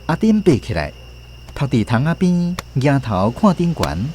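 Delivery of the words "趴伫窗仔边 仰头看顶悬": ordinary delivery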